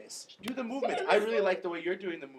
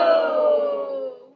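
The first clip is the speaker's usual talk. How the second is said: huge booming shout, far higher and louder; giant voice; 12 dB louder than usual. Shown as a demonstration. Teacher leads the class in a booming giant voice. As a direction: belt it out like a giant. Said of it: "With a giant voice".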